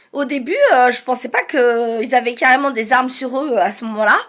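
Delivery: plain reading voice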